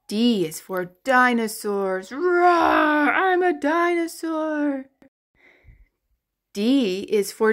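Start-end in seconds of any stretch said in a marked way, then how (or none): 2.1-4.9 playful roaring dinosaur. Pretend. Teacher plays a roaring dinosaur for kids. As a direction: roar like a friendly dinosaur.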